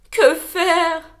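loud panicked cry. Acted. Wolf hears cracking in the bushes and panics loudly. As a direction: blurt it out in a panic. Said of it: "paniqua Loup".

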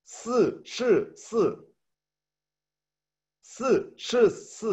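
"si, shi, si" said high and crisp; Mandarin tongue twister. Shown as a demonstration. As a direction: demo the Chinese twister slowly. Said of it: "vamos a decir cuatro es cuatro".

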